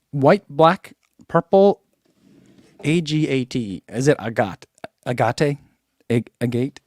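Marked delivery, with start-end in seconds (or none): 4.2-4.7 agate said "uh-GOT"; trial pronunciation. Shown as a demonstration. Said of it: "I don't know how to say". 5.1-5.6 agate said "uh-GAH-tay", questioning; trial pronunciation. Shown as a demonstration. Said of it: "I don't know how to say". 6.1-6.8 agate said "uh-GATE", after a false start; trial pronunciation. Shown as a demonstration. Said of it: "I don't know how to say".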